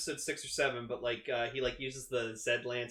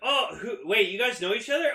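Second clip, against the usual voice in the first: surprised character voice; 7 semitones higher, 5 dB louder than usual. Retold or imitated. Character reacting in scene.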